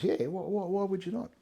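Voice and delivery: mock astonished question, voice jumping up; incredulous. Mate surprised he hasn't told God, imitated with high incredulity. Mate's incredulous reply retold.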